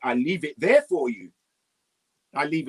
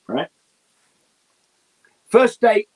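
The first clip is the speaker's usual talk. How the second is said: usual speaking voice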